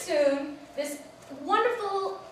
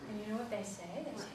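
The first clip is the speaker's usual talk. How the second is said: plain reading voice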